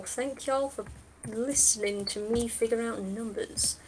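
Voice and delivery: ordinary talk, nothing marked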